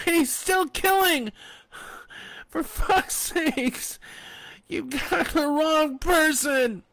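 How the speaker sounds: high, loud, slow screaming; furious scream. A narrator's reading. Fanny screaming at the sheriff in outrage. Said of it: "she screamed".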